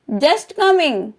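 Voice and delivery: slow, clear "just coming" for learners; slow model repeat. Shown as a demonstration. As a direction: draw out "just coming" slowly. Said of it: "teacher models phrase twice".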